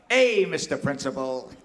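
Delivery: loud, mock-indignant call; kid yelling out. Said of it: "yells out".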